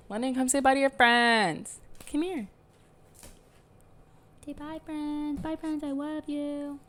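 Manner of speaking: cooing goodbye to the dog London; pet talk. Real, not acted. Talking to her dog London.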